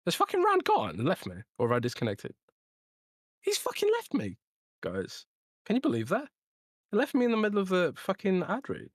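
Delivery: high-pitched, incredulous outrage; indignant disbelief. Real, not acted. Host discovering his co-host left mid ad read, indignant disbelief. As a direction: voice leaping up in baffled protest.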